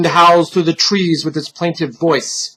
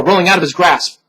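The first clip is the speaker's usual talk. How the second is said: plain reading voice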